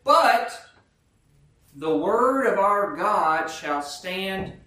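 loud, high BUT, then slow and weighty; emphatic proclamation. Real, not acted. Preacher contrasting fading grass with God's word, emphatic proclamation. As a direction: declare it as an unshakable promise. Climax of the scripture reading.

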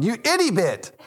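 put-on high, cute childish garble of an insult; a little kid parroting dad; toddler mimicry. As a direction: daughter copying her father's insult, childish high voice. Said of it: "she was walking around the house going".

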